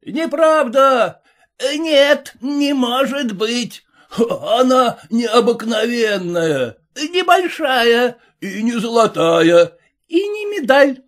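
high, loud mocking cries; crowd shouting. A narrator's reading. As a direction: jeer at the medal from all sides. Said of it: "Со всех сторон кричали".

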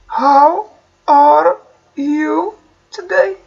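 very slow, word by word; slow model phrase. Shown as a demonstration. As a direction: drill it slowly for the class. (ببطء شديد)

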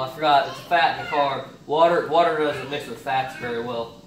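ordinary talk

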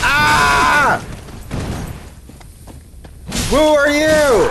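yelped in shock, then a drawn-out high challenge; startled yelp. Real, not acted. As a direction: jump and demand who's there. Attacked by enemy, startled shout.

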